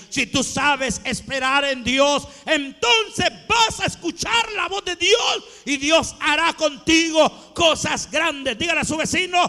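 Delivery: loud, high exhortation building to a shout; preaching climax. Real, not acted. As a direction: drive it home, rising to a full shout. (prédica encendida, a gritos)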